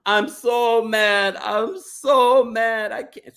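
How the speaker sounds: high, slow, drawn-out mock outrage; mock "so mad". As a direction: wail the complaint in an exaggerated imitation, stretching SO. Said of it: "she quickly switches over from".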